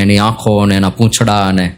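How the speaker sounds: ordinary delivery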